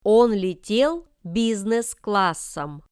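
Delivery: usual speaking voice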